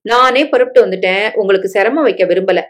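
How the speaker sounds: plain reading voice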